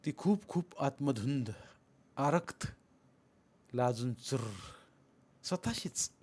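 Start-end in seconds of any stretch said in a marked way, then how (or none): none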